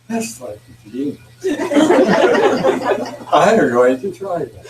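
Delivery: words squeezed out between laughs; laughing through the line. Real, not acted. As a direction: let the laugh break up the words. Teller laughing through drunk's lines.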